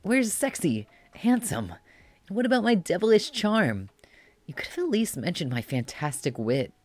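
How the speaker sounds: put-on high, preening indignant boy voice; vain bratty boy. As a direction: whine like a vain little devil. Vain character protests.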